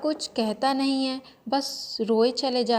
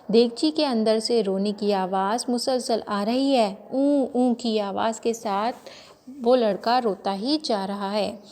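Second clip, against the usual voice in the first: usual speaking voice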